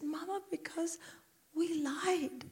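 put-on high, very soft child's confession; little daughter. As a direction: confess to mum in a small voice. Voices daughter confessing in cinema.

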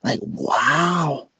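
high, wide-eyed gasp of surprise; son amazed. Retold or imitated. Son reacting to dad's sex talk, stunned and amazed. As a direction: sound floored, like hearing it for the first time. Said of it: "and he was like".

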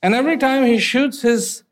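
ordinary delivery

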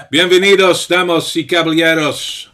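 booming ring announcer welcome; lucha libre announcer. Acted. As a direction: roar it like a wrestling host. Announcer opening the stream.

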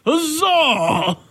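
high, then very slow triumphant proclamation; mock heroic cry. To the hosts, a hammed-up heroic cry. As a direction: cry out like a storybook hero.

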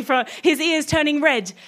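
usual speaking voice

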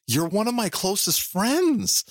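slow, high, hammed-up hurt; mock offended. Telling his podcast friend off, mock hurt. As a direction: pretend to be wounded by a friend. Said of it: "And I was offended".

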